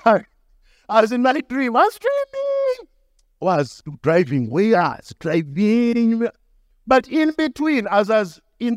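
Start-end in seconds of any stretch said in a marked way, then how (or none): 0.0-2.9 high, slow, sing-song dreamy voice. Retold or imitated. Preacher recalls his childhood dream, high floaty voice. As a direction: drift off like in a dream.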